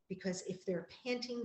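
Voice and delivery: usual speaking voice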